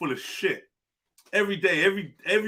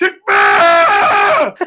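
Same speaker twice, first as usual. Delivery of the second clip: a much louder shouted Takbir!, called out; imitated war cry; 4 semitones higher, 13 dB louder than usual. Mocking the call again, shouted loud. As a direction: roar "Takbir!" as a rally cry. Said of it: "in that voice".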